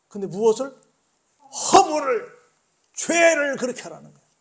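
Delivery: loud, high impassioned preaching; preaching at full force. Acted. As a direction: cry it out like a fired-up preacher.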